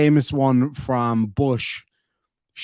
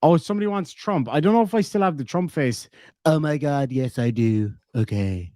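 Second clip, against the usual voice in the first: usual speaking voice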